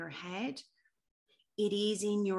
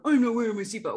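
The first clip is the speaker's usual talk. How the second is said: loud, raised, slurred mock shout; mock boxing-ring yell; 4 semitones higher, 9 dB louder, faster than usual. Ad's lad in boxing ring refusing seatbelt, mock boxing-ring yell. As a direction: imitate a boxer hollering from the ring. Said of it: "in a boxing ring going".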